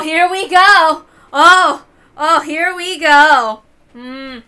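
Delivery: usual speaking voice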